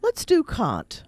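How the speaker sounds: a light take on the stern professor; quoting the professor. Retold or imitated. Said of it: "professor said".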